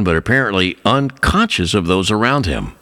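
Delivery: usual speaking voice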